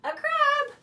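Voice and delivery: high, loud reveal of the answer; excited confirmation. Pretend. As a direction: cheer the right guess. Cheers children's correct guess.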